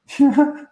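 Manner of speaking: quick, high, loud laugh at her own joke; burst of laughter. Real, not acted.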